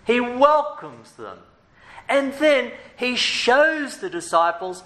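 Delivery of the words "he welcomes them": "welcomes" drawn out high and slow; stress on welcomes. Shown as a demonstration. Preacher to congregation, "welcomes" stressed slow. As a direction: lean hard on "welcomes", slowly. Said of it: "Can you imagine that?".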